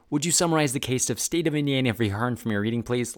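tongue-twister speed, no stops; rapid-fire. Shown as a demonstration. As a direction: say it all in one fast run. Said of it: "like, that fast".

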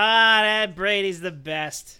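put-on slow, drawn-out Boston accent. Boston fans praising Tom Brady, slow broad accent. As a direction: savour it like a Boston fan. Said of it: "the Boston version".

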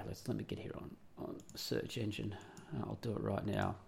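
normal talking voice

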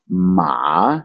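"ma" dipping down and back up; long dipping "ma"; third tone. Shown as a demonstration. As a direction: drop "ma" into a valley, then lift it. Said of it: "it's like it drops in".

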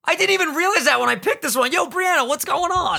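real high-pitched, delighted outburst; high, thrilled shouting greeting; excited surprise. Real, not acted. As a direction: exclaim in astonished recognition. Recognizes the listener who wrote in.

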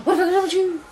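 put-on parent voice, high and loud; rapid mock scolding.

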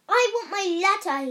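high, loud, fast shout. Acted. The boy shouts at his uncle for his letter, loud and fast. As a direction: shout it at him. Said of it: "he shouted".

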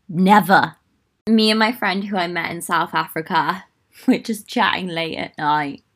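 ordinary delivery

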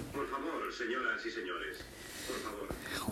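mock-secret whisper begging everyone. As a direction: lean in and whisper to the listeners. Sudden hushed appeal to audience.

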